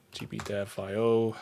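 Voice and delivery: usual speaking voice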